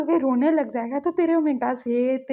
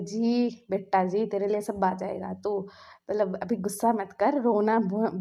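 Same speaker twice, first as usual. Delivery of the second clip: soothing; 4 semitones lower than usual. A narrator's reading. Dad gently soothes his crying son, low and slow. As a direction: lower and slow, gentle calming.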